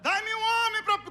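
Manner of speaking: high, loud bellowing giant; giant shouting. Retold or imitated. Preacher imitating the giant shouting for forty days, a loud high bellow. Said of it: "quarenta dias gritando".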